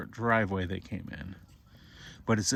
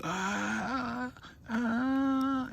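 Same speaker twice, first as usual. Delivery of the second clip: very high ghostly moan; eerie creature wail; 13 semitones higher, 3 dB quieter than usual. Acted. Skeleton sister turning to her brother with a very high eerie moan.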